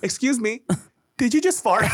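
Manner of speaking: high, giggling polite question to a stranger; laughing mock question. Pretending to ask a diner about a fart, laughing high. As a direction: ask it while barely holding laughter. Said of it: "if you do that at a Korean restaurant".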